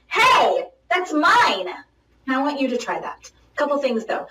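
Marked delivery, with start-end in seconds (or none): 0.1-1.7 firm, higher calling voice. Shown as a demonstration. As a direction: call out to stop a thief. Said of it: "is call out".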